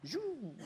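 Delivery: whooshing mock ray gun noise; high swooping zoom. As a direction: make a sci-fi zap sound. Ray gun sound effect.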